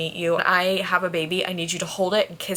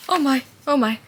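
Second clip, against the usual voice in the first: louder and higher gasping exclamation; stunned; 5 semitones higher, 5 dB louder than usual. Real, not acted. Reader hitting the book's big moment, stunned out loud. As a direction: blurt it out in shock.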